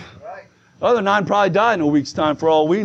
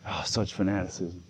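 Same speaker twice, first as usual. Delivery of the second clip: very low, soft disapproving aside; mock disdain; 13 semitones lower, 13 dB quieter than usual. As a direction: imitate a critic sighing under the breath. Mimics a disdainful church elder.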